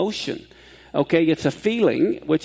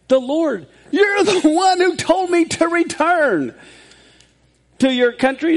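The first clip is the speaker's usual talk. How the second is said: pleading prayer; 11 semitones higher, 5 dB louder, slower than usual. Acted. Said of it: "Jacob says".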